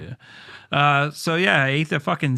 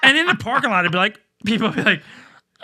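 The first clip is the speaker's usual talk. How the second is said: hyped retelling; 7 semitones higher, 5 dB louder, faster than usual. Retold or imitated. Excited parking lot crowd retold.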